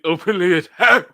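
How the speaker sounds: put-on loud, booming old villain voice, rising; Darth Vader. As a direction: boom it like an aging dark lord. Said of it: "I need Old Man Vader voice again".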